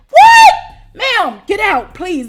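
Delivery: very high, loud, slow yell; furious shout. Retold or imitated. Throwing the roommate out, furious shout. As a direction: scream her out the door. Final outburst.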